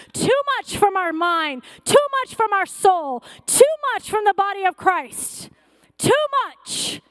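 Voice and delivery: preacher crying out at the climax; building sermon climax. Acted. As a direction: build the cry to a peak.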